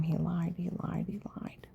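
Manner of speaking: whispered, almost no voice; bitter whisper. Real, not acted. A mother telling listeners her son lied, in a whisper. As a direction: breathe it out under the breath, bitter.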